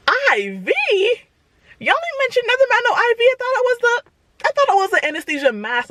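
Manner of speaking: high, fast, alarmed rant; shocked disbelief. Retold or imitated. To the doctor, high rushed alarm. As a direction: squeal it out in disbelief about the needle.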